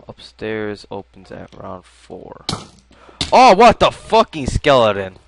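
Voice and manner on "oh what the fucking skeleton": loud, higher-pitched burst of alarm; startled yell. Real, not acted. Gamer jumped by a skeleton, startled yell. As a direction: cry out at a sudden attack.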